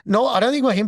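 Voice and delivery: ordinary delivery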